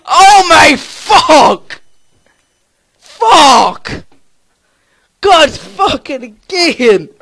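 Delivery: loud, high, drawn-out curses; anguished shouting. Real, not acted. Gamer cursing the helicopter going wrong, anguished shouting. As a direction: wail each curse in dismay. Swearing after the crash.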